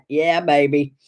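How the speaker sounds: ordinary delivery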